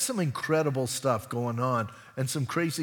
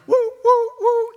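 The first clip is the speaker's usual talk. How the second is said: high, loud playful whoops; excited whooping; 11 dB louder than usual. Retold or imitated. Preacher imitates little Johnny leaping in the womb, excited whooping.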